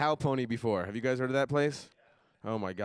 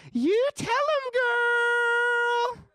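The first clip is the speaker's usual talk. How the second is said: very high, loud, drawn-out falsetto holler; yelling out; 6 dB louder, much slower than usual. Retold or imitated. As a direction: cheer her on through the window, stretching the last word. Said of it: "I just yell out".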